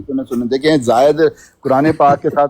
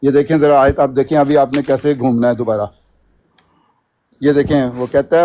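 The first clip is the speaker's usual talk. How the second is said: ordinary talk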